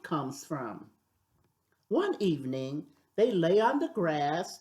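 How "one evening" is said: ordinary delivery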